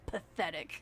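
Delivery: ordinary delivery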